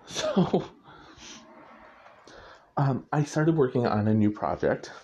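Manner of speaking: usual speaking voice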